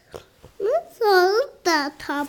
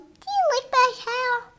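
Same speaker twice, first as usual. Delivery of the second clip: high character voice; 6 semitones higher than usual. Acted. Child voicing dog again.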